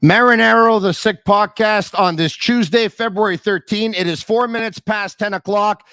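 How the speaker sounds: big booming radio host open; the host hypes his show open to listeners, booming announcer voice. Acted. Announce the show loud and proud.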